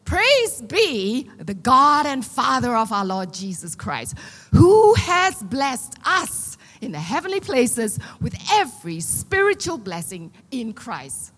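slow, loud, measured reading of the verse; scripture reading. Acted. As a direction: read it out to the church, weighty and slow.

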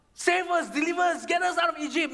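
high, urgent shouted plea; crying out. Acted. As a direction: mimic a crowd begging for rescue. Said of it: "crying out to God".

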